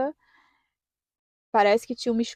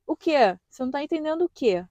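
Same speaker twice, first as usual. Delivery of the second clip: mock rude examiner; 5 semitones higher, 3 dB louder than usual. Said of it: "Aí ele falou assim".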